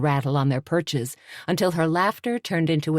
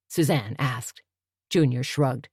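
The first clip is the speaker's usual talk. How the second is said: usual speaking voice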